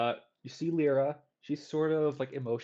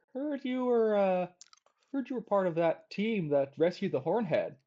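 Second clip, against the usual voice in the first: higher, mocking half gnome kid voice; needling Ren; 7 semitones higher, 4 dB louder than usual. Half gnome kid needles Arvin, higher and mocking. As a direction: poke at him with fake interest.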